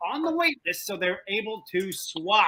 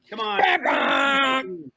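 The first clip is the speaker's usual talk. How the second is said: excited shout; 8 semitones higher, 7 dB louder than usual. Real, not acted. Host urging players, cries out.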